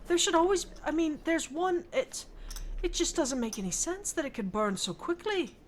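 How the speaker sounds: high-pitched, bewildered protest; distressed disbelief. Acted. Upset visitor questioning how the house could burn, distressed disbelief. Upset character reacts to the fire.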